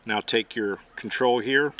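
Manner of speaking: normal talking voice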